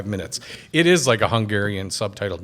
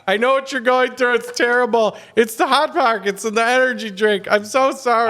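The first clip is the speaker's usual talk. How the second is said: over-the-top apology; 11 semitones higher than usual. Retold or imitated. Man at the sink apologizing to the waiting stranger, hammed-up high grovel. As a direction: beg forgiveness over and over, pitched way up. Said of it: "standing at the sink going".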